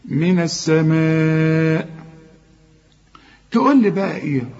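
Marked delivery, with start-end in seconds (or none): 0.1-2.0 السماء with the alif stretched four beats; long madd recitation. Shown as a demonstration. Teacher chanting the long madd for students. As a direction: stretch the vowel before the hamza. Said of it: "لازم تمد المد ده أربع حركات".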